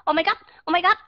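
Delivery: high, loud learner's "God" cut short like "got"; mimicked mispronunciation. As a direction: clip the vowel of "God", ending on a t. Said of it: "Họ dùng cái chữ God".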